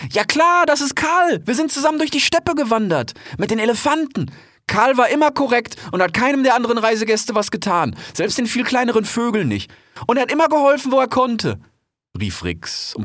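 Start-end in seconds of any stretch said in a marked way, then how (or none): 0.1-0.7 very high, loud, "klar" rising brightly; a quick eager yes, "klar" lifting up; young fox vouching for Karl, high and loud. Acted. Rix vouches again. 0.7-11.6 very high, loud youthful cry of delight; young fox thrilled to see his friend, high and loud; excited young fox. Acted. Rix vouches for Karl.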